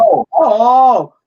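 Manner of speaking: loud, rising oh oh oh; shocked realization out loud; sudden loud alarm; surprised. Real, not acted. Shocked reaction.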